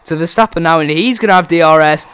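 loud, high excited race call; excited commentary. Acted. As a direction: shout the re-pass with excitement.

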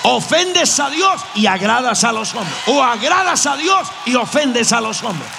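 preaching at full force. Real, not acted. Preacher rousing the congregation, fiery full-force preaching. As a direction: drive the point home to the crowd.

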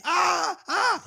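high shouted mock scream of victims. Dad telling his son the bedtime story, shrieking a mock scream. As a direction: yelp it high like someone in boiling water. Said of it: "they come out screaming".

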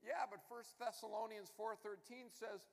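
full-force preaching. Acted. Pastor preaching hard to his congregation, fervent and loud. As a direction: preach it out, building to the rhetorical questions.

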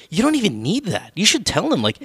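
high, sing-song mocking complaint; high-pitched exaggerated pleading; mock protest. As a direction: swoop the pitch up in exasperated mockery. Mocks the pretentious Russian-literature shtick.

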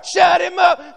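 high, loud angry order; crowd yelling. Acted. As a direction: yell to silence him. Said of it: "Will you shut him up?".

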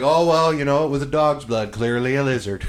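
mock officials. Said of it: "They're just like".

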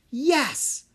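a bright high cheer of joy; quick excited yes. A narrator's reading. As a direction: cheer it out like a happy girl. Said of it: "Anna cheered".

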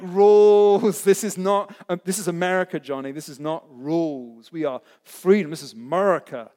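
loud, mock American accent on "Rules"; a hammed-up American accent, sure of his freedom. Preacher mocks a rule-wary American listener in an American accent. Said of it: "uh, American accent right there".

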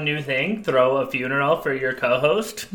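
ordinary delivery

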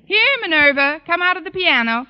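high, loud call to a hidden cat. Acted. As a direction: sing out her name toward the piano. Calling the cat from the piano.

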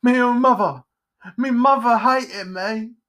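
high, loud woeful lament; self-pitying Pumpkinhead. Acted. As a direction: wail melodramatically about being unloved. Pumpkinhead laments his mother.

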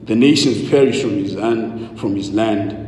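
hushed solemn scripture reading. Real, not acted. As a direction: read the psalm gently and solemnly. Scripture reading before sermon.

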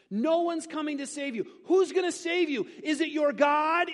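rising to a high, loud mocking taunt; taunting Assyrian king. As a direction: taunt him like an arrogant king demanding surrender. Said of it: "again said to him".

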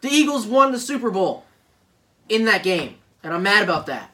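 high, shouted fury at a rival team; loud, indignant rant; pissed. Real, not acted. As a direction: yell it out, outraged. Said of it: "I'm pissed!".

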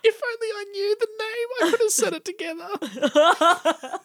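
a cartoon sidekick. Cartoon scene voice, high.

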